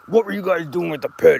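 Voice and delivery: a hint of an angry man grabbing kids; gruff accusing question; mild mock anger; retold angry man.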